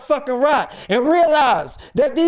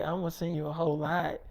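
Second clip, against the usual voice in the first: much softer, low flirty murmur; 8 semitones lower, 14 dB quieter, faster than usual. Retold or imitated. Erica to David on the phone, soft flirty murmur. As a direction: coo it down the phone.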